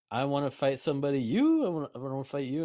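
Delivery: loud, high burst of excitement; eager challenge. Acted. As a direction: pick out an opponent with gleeful eagerness. Excited Ulrich picks an opponent.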